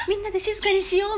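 put-on high, sweet girlish voice; gently rally the class like a kind schoolgirl; kind girl's voice. Kind girl urging classmates to hush, high and sweet. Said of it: "優しい女の子がいるでしょ？".

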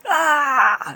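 high, loud mock yell of panic; imitating a loud panicked shout; retold yell. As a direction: yell it like a startled coworker. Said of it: "って叫んでた".

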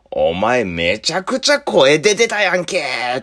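slow, drawn-out teasing jab; teasing senior. Retold or imitated. A senior slowly teasing his junior about the noise he made.

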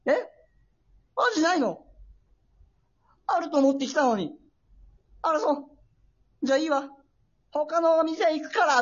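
high-pitched mock outrage, not truly shouted; retold angry customer. Angry customer snapping at a fish-counter clerk, mildly mock-shouted. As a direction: act out an indignant customer storming off. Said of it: "おっきな声で叫んで".

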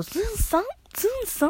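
high, innocent questioning call; mimicking Belle. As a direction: chirp the nickname up high, puzzled. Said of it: "ベールちゃんがアップルポイズンさんのこと". (高い声で不思議そうに呼びかける)

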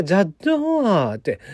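ジャットー with the final ー stretched long; ジャットー said slowly, vowel drawn out; ジャットー drawn out like the old folks say it; old Kagoshima word. Shown as a demonstration. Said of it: "昔の人は、".

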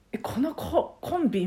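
mock disbelief, pitch jumping up on a halting question; retold surprise. YouTuber recalling an unimaginable pairing on screen, retold surprise. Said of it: "全然ちょっと想像できなくて".

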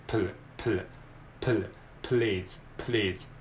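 "プル" run together into "please"; blended P-L. Shown as a demonstration. Teacher drilling p and L joined in "please". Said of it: "これを連続で".

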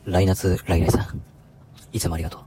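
seduce the listener like a smooth radio idol; cool ikebo voice. Acted. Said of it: "イケボで".

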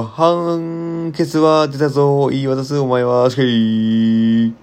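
slow, deliberate recitation of a poem-like tale; storyteller intoning a grim little tale; recited tale. A narrator's reading. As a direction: read it like a measured verse, slowly.

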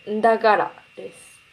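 んだがら said with a voiced が in Akita dialect; んだがら, a clear nasal ん then a voiced がら; Akita dialect word. Shown as a demonstration. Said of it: "今日の言葉".

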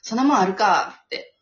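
exasperated retort. Retold or imitated. Retold exasperated inner outburst.

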